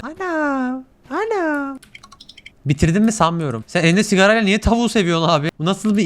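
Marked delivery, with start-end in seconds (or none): 0.0-1.8 high, thin mock cry for mum; mock wailing. Faking a child's wail after the character stands up. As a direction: wail like a hurt child, half joking.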